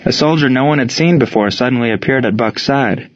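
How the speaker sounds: ordinary delivery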